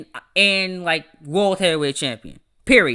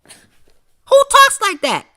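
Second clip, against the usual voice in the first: high-pitched incredulous shout; drawn-out, exasperated high holler; exasperated yell; 10 dB louder, much slower than usual. Real, not acted. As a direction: yell it in loud, squealing disbelief. Exasperated outburst after mocking demo.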